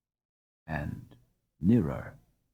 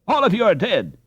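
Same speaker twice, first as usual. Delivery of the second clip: high exasperated call; 7 semitones higher than usual. A narrator's reading. Peter calls out to his sleeping friends. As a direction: rouse the sleeping boys.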